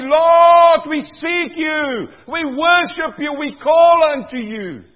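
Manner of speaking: loud, high prayer cry; preacher models a loud prayer for the congregation. Shown as a demonstration. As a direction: lift your voice and cry to the Lord. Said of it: "We say".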